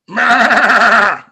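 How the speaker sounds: loud, high, wavering bleat; two goats bleating at once. Acted. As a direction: belt out a long goat "meh". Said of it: "Both of the goats at the same, same time go".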